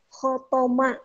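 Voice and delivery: khotoma with kh and an o in the second syllable; Arabic word khotoma. Shown as a demonstration. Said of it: "Beda to dengan ta". (khotoma dengan bunyi to)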